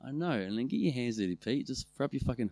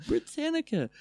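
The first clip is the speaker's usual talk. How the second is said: high mocking echo. Mocking repeat of Britannica.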